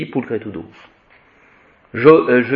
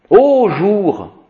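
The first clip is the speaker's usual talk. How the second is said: lifted, solemn "ô jour" called out higher; declaimed address; 8 semitones higher than usual. Shown as a demonstration. Teacher showing the vocative with a high, solemn call. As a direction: hail the day like a poet.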